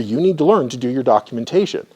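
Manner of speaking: ordinary talk, nothing marked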